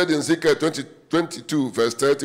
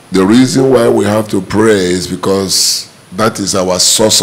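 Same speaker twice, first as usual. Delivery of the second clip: low, steady prayer voice; praying aloud; 5 semitones lower, 10 dB louder than usual. Acted. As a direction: pray over the congregation. Leads a congregation prayer.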